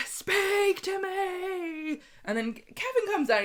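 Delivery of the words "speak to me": very slow, higher drawn-out plea; pleading Abby. Retold or imitated. Abby begs silent Sam to speak to her. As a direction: stretch it out begging him. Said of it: "She's like".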